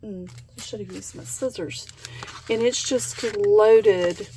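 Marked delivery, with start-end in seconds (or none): none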